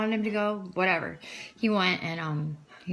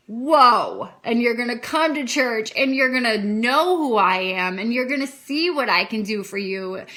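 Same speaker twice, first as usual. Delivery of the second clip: booming voice of God; 4 semitones higher, 9 dB louder than usual. Retold or imitated. God's promise quoted.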